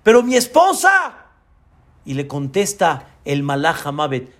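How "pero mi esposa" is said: a high, louder cry for his wife; dying man crying out for his wife, desperate. Acted. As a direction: cry out, panicked. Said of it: "el hombre grita y dice".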